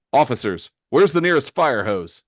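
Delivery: loud, urgent call for help. Acted. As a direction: shout to officers across the room. Hero calls to officers in battle.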